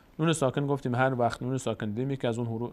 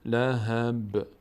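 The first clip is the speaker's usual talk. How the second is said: drawn-out chanted phrase; slow recitation; much slower than usual. Shown as a demonstration. Teacher recites the phrase for students, chanted. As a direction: recite slowly, stretching each vowel. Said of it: "recites verse".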